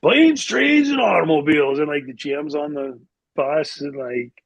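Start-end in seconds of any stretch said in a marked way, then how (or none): 0.0-1.8 high, loud holler from the back of the bus; tipsy teammate hollering. Retold or imitated. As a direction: draw it out like a merry loudmouth who's had a few. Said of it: "Theo was still feeling pretty good".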